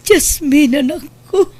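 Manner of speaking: crying out. Acted. Mother weeps over her daughter after the house is wrecked, crying. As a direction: voice breaking on a high cry.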